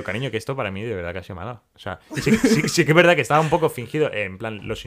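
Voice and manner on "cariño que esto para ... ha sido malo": put-on low and soft, sincerely telling his girlfriend; a quiet heartfelt plea; softly explaining to his girlfriend that it hurt; earnest confession. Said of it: "digo, digo".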